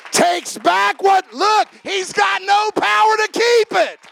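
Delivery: full-force preaching. Acted. Pastor preaches grace to the congregation, booming. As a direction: drive it home to the congregation.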